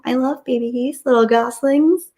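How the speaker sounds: cooing. Real, not acted. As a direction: coo over the goslings. Cooing over baby goslings.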